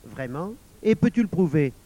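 mildly severe questioning; firm, sceptical question; stern. A narrator's reading. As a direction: challenge the boy to back it up. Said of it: "demanda sévèrement le caissier".

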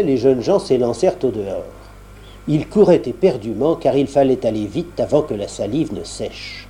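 usual speaking voice

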